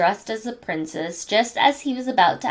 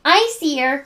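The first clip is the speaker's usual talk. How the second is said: higher, louder eager call; excited cry; 6 semitones higher, 4 dB louder than usual. Acted. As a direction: point and shout it out. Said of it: "cried Kimmy".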